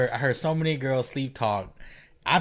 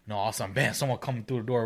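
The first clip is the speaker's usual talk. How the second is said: ordinary delivery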